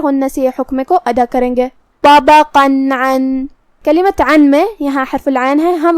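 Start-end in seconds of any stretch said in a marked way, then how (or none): 2.0-3.5 طبقاً عن with clear nun, no nasal hold, slow; clear tanween. Shown as a demonstration. Teacher showing izhar, slow demo. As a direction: say طبقاً عن with the nun sounded plainly. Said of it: "ہم یہاں تنوین ظاہر کریں گے".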